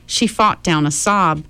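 ordinary delivery

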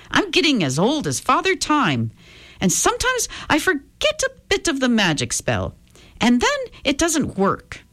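put-on high, lilting old leprechaun voice. Leprechaun apologizes to the soaked girl, high old voice. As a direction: play a kindly old leprechaun, pitched well up.

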